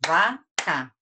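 "vaca" as two separate beats, va, ca; syllable by syllable. Shown as a demonstration. Teacher claps the word for pupils to count, syllable by syllable. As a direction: clap out "vaca" with a pause between syllables. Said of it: "Quantas palmas eu bati?".

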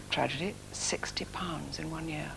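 hushed, slow rueful whisper; a whispered tragic confession; whispered aside. Real, not acted. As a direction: drop to a near whisper, slowly. Rueful aside about poor earnings.

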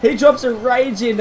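normal talking voice

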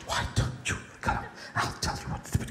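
soft whisper to the horse. Pretend. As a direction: barely whisper it. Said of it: "I said".

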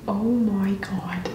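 breathy whisper. Real, not acted. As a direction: whisper it in disbelief. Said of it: "Talk in an Australian accent".

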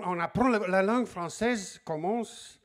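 normal talking voice

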